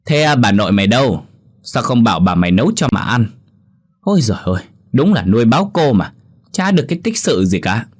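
tired mother snapping at her son; angry snapping. Acted. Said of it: "Thị hầm hầm quát thằng bé".

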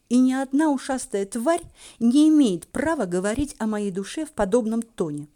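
plain reading voice

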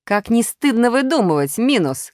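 high, indignant call; shouted reproach. A narrator's reading. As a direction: cry out a scolding from outside. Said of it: "крикнула Пятёрка".

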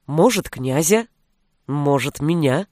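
low, teasing questions; sly smile. A narrator's reading. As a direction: tease her with a sly, intimate suggestion. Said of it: "улыбнулся он хитро".

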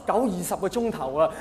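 ordinary delivery

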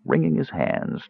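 ordinary delivery

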